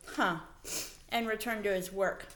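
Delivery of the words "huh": ordinary delivery, nothing marked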